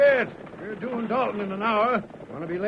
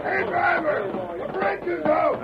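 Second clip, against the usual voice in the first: higher, slow hail to a driver far off; calling out; 8 semitones higher than usual. Acted. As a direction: call across the creek. Outlaw hails the stage.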